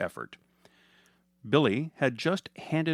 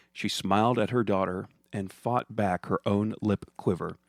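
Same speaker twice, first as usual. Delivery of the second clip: plain reading voice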